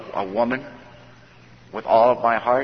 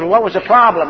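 raised, louder demanding question; sponsor's sharp question; 9 semitones higher, 10 dB louder than usual. Retold or imitated. Sponsor on the phone demanding answers, raised and loud. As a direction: bark it back at him like a tough sponsor.

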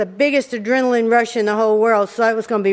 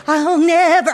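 higher-pitched mock hysterics; mock hysterical; 4 semitones higher, 3 dB louder, slower than usual. Wife mimics furious husband after tow, mock hysterical. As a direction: imitate him quivering with outrage. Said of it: "he was just absolutely hysterical".

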